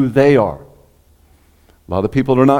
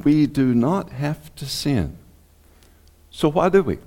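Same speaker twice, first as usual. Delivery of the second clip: usual speaking voice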